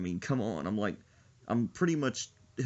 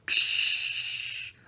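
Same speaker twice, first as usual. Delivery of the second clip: spray noise; 8 dB louder than usual. Pretend. Spray sound effect.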